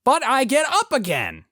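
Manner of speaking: hammy nasal shout with exaggerated emphasis; David Lynch. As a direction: holler each phrase on a long high note, dropping at the end. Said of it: "literally nasally voice, scream, pentameter".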